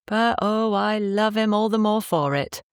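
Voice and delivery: a hint of a proud, affectionate wife; fond wife voice. A narrator's reading. Wife to Poppy about her husband, lightly voiced and fond.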